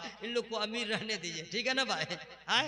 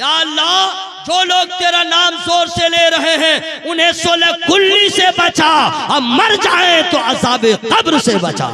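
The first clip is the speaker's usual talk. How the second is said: slow, much louder and higher plea to God; shouted prayer; 6 semitones higher, 20 dB louder than usual. Acted. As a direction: cry the dua out to the whole hall. Dua climaxes in full shout. (ज़ोरदार पुकार वाली दुआ)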